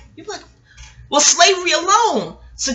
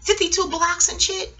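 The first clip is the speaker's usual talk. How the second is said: higher, excited question; higher amazed gushing; amazed quote; 8 semitones higher, 4 dB quieter than usual. Retold or imitated. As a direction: gush about his fighting moves. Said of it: "I says".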